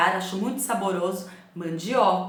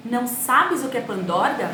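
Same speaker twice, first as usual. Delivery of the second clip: put-on southern child's question; 5 semitones higher, 5 dB louder than usual. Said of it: "as crianças perguntavam assim".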